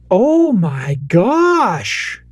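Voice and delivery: drawn-out, awed oh my gosh; thrilled little kid. Retold or imitated. Toddler daughter opening Christmas presents, thrilled. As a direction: imitate a toddler's slow, swooping amazement. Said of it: "She keeps saying".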